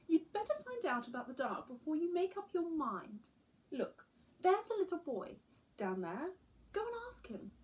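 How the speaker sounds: a patient owl mum urging her chick on; mother owl voice. Acted.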